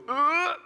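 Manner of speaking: high, exasperated ugh of a whole room; a loud cringing groan; awkward groan. Retold or imitated. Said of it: "The entire room goes".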